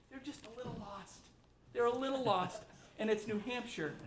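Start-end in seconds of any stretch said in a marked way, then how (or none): 0.1-1.2 a quieter, gentle dropped line; soft aside. Real, not acted. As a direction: say it almost kindly under your breath. Softening criticism of senators.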